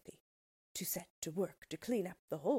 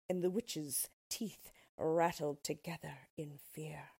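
ordinary delivery

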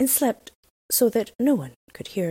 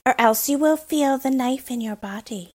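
put-on a lightly raised, bold robber girl voice; brash young robber girl, a touch higher; 7 semitones higher, 3 dB louder than usual. A narrator's reading. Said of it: "said the robber girl".

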